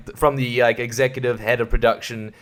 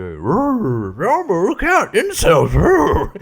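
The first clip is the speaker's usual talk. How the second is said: playful fake creature howl; mock monster roar; 6 semitones higher, much slower than usual. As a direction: roar it like a cartoon beast, half laughing.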